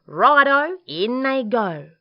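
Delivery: high, loud, jolly go-ahead; cheery agreement. Acted. Dingo accepting Emu's feathers, loud and eager.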